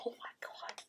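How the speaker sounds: breathless whisper of amazement; awed whisper. Real, not acted. Opening the package, whispering in awe.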